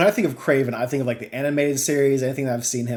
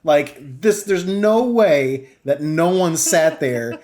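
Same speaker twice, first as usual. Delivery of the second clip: raised-pitch exasperated disbelief; incredulous outrage; 6 semitones higher, 3 dB louder, slower than usual. Retold or imitated. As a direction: demand to know who let this through. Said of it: "I'm like".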